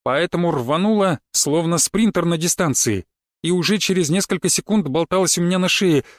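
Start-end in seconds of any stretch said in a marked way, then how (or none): none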